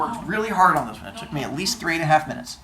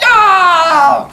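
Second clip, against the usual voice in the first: loud high scream off a cliff; falling scream; 14 semitones higher, 13 dB louder than usual. Acted. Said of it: "we kinda just went".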